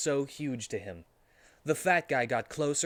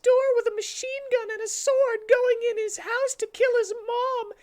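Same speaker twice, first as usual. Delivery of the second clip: put-on squeaky high girl voice, louder; high Amy voice; 8 dB louder than usual. Amy tells Sonic about Shadow, squeaky high voice. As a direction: pitch way up as Amy, shaken.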